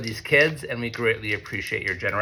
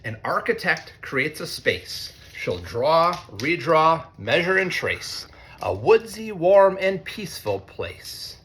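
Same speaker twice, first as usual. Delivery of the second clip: storybook rhyme, pitched higher and brighter; reading to children; 6 semitones higher, slower than usual. A narrator's reading. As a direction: lift the rhymes for a young audience. Reads picture book to students.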